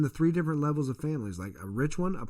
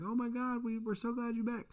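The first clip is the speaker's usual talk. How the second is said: put-on higher and softer, a light gushing girl voice; excited girls; 8 semitones higher, 6 dB quieter than usual. Voicing campus girls greeting the twin, a light gushing imitation. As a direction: lightly play girls thrilled to see him. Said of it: "girls coming up and kissing him".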